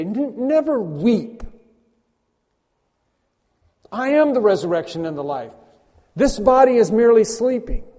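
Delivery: high, loud earnest proclamation of Jesus; voicing Jesus. Jesus speaking to the mourners, lightly voiced earnest proclamation. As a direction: speak as the Lord comforting mourners. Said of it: "Jesus Christ could say".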